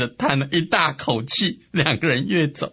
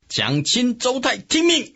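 shouted summons; 8 semitones higher than usual. A narrator's reading. Angry Sun Quan summoning generals, high commanding call. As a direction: order jumping high at the end. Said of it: "他嚷着".

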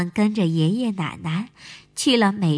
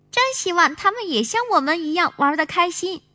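put-on high, bright little girl; sweet child voice; 10 semitones higher, 4 dB louder than usual. Little sister character voice.

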